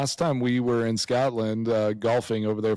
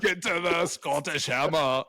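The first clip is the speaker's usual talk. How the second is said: higher, slow mock Austrian accent; Arnold Schwarzenegger; 5 semitones higher than usual. Joining the joke about own accent, hammed-up Austrian. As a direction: Austrian action-hero accent, words drawn out. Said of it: "Arnold Schwarzenegger".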